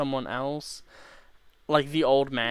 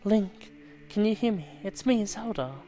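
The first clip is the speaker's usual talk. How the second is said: higher princess voice; Zelda voice; 6 semitones higher than usual. Acted. Voicing Zelda calling Link.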